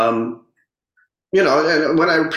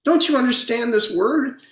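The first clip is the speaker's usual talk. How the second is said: ridiculing aunt; 8 semitones higher than usual. Retold or imitated. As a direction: talk down to the kid, condescending. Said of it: "And she says".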